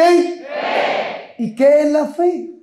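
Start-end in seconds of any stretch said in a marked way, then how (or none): 0.0-1.4 call for answer. Shown as a demonstration. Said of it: "¿No tienen qué?".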